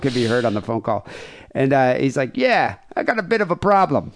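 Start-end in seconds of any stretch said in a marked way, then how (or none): 2.4-4.1 put-on higher, half-hearted Australian accent; a casual Aussie bloke, lightly put on. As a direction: killer casually explaining to electrician, faint Australian accent. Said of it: "picture this in an Australian accent".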